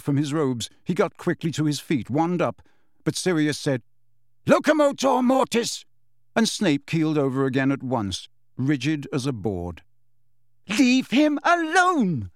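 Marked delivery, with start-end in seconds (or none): 4.5-5.8 high, forceful incantation; cast spell. A narrator's reading. Sirius casting a curse at Snape, forceful incantation. 10.7-12.3 high, drawn-out protesting shout. A narrator's reading. Lily defending Snape from James, high indignant shout. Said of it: "Lily shouted".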